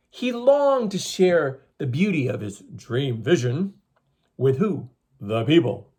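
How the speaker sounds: slow, expressive storyteller voice; story reading for children. A narrator's reading. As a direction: read the legend to the class, drawn out. Teacher reading legend to children.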